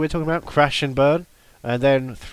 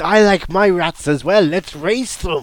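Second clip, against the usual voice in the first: loud, higher mock Shrek brogue; Shrek accent; 5 semitones higher, 5 dB louder than usual. Co-host showing off Shrek to his partner, hammed-up Shrek accent. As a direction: put on a hammy Shrek accent. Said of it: "be able to do that accent".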